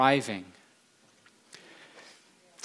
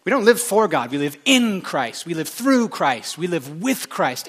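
FOR, IN, THROUGH and WITH each punched; stressed prepositions; 3 semitones higher, 4 dB louder than usual. Real, not acted. Preacher picky about prepositions, stressing for, in, through, with. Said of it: "I'm picky about prepositions".

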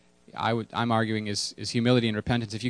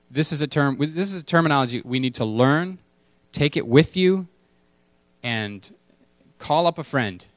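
ordinary talk, nothing marked

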